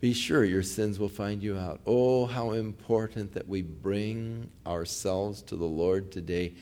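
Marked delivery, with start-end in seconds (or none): none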